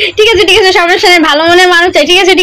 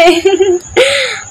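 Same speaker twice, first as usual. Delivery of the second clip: giggle; 4 semitones higher, 3 dB quieter than usual. Real, not acted. Laughs at own hype.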